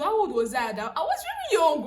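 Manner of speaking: loud, high burst of amused protest; laughing exclamation. Real, not acted. Looking back at how young she was, loudly laughing. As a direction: exclaim it through real laughter.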